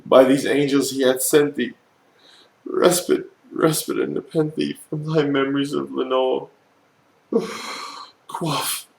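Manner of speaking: loud pleading cry. A narrator's reading. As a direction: plead loudly, torn with grief. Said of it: "I cried".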